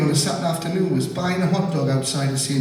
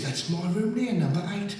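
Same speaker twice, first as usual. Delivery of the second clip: night watchman; 7 semitones higher, 4 dB quieter than usual. Retold or imitated.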